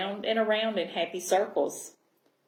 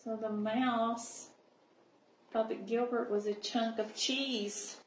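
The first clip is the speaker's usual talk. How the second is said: soft aside; 7 dB quieter than usual. Acted. Teacher asides to children.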